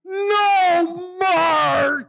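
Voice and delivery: high, loud, crying no; anguished groan. Retold or imitated. Dad seeing the bent car door, crying out in dismay. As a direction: wail it like a heartbroken dad.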